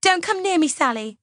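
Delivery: high, alarmed cry falling on the name; high-pitched panicked warning; shrieked warning. A narrator's reading. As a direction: shriek as if backing away in fear. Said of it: "she shrieks suddenly".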